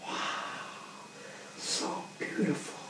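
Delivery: whispered, full of awe; hushed whisper. Acted. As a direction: breathe it like a gasping crowd. Crowd marvels in hushed awe.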